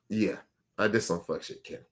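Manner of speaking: mumbled, guilty owning up; imitating her meek admission; sheepish confession. Voicing young woman's sheepish confession.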